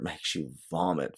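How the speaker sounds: plain reading voice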